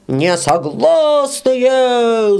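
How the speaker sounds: very slow, high drawn-out protest; crowd shout. Acted. All the little peasants shout their refusal at the beetle. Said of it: "закричали все мужички".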